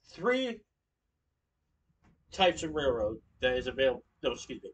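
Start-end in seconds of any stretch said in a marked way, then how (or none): none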